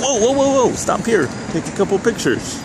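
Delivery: high-pitched run of whoas, easing down; retold sudden halt. Vlogger retelling his last-minute stop for pictures, surprised. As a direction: call a halt to yourself, acting it out. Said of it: "and then I was like".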